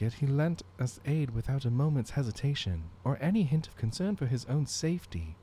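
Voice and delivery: noble character voice. Acted. Voicing game character lines.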